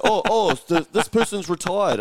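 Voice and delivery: high, loud mock panic over a trivial news alert; hammed-up alarmed phone addict; mocking news-app reader. Mocking people who panic at their news alerts, high and loud.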